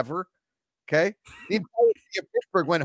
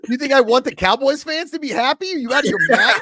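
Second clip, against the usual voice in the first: loud, high disbelief; how dare you suggest that; outraged; 9 semitones higher, 8 dB louder than usual. Real, not acted. Fan snapping at his cohost, outraged and loud. Outraged at Cowboys fans comment.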